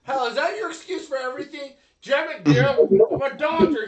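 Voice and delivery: high, shouted teasing; loud mock exasperation. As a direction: heckle loudly in mock outrage.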